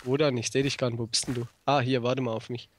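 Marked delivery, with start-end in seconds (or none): none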